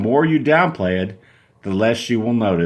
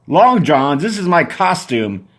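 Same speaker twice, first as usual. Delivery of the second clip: put-on offended boyfriend; 5 semitones higher, 4 dB louder, slower than usual.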